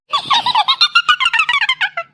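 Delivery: long, very high cackling laugh; shrieking laugh. Acted. A friend laughs hard at the fridge joke, long and very high.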